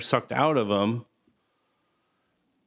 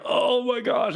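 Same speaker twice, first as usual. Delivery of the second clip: much higher mock gasp of shock; 11 semitones higher than usual. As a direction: recoil in mock horror. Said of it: "I was like".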